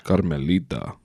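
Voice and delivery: a hint of a put-on Spanish accent; Spanish twang. Friend reading names with a playful Spanish twang. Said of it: "put in some Spanish twang on that shit".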